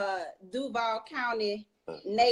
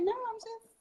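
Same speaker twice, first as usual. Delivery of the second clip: mock own reply; 13 semitones higher, 5 dB quieter than usual. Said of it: "I'm like".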